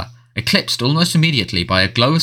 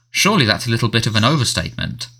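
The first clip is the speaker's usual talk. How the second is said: ordinary delivery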